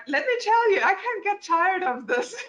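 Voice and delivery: high, excited laughing delight. Real, not acted. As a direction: giggle through it with real joy. Delighted by her own ultrasound demo.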